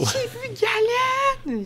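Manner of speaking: high, slow, drawn-out squeal of a name; thrilled shout. Retold or imitated. Tells her boyfriend she saw Galen, squealing. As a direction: squeal it out, starstruck.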